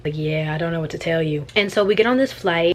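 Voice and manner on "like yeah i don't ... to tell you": low whispered aside to the viewers. Real, not acted. Vlogger whispers a dry aside about the panicking guy. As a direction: breathe it like a secret joke.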